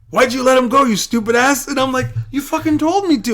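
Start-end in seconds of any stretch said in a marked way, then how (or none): 0.1-1.7 imitated coach's high, loud telling-off; scolding coach. Coach blaming wrestler after the escape, scolding loudly. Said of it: "my coach is like". 2.3-3.3 high, loud, indignant comeback; indignant protest. Retold or imitated. Wrestler protesting to his coach, indignant.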